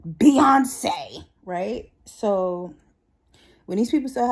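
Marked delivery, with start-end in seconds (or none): 0.1-1.2 high, loud, gushing over a star's name; excited name drop. Retold or imitated. Coworker gushing to the office about [name], high and loud. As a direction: blurt the name out with delight. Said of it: "she was like".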